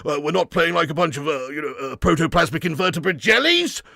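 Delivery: blustering, halting politician voice; Boris Johnson. Acted. Former Prime Minister hailing England's play, blustering impression. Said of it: "Boris Johnson".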